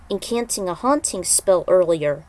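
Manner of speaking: ordinary delivery, nothing marked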